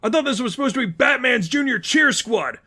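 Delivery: trapped villain protests the unfair trick; outraged giant. Acted.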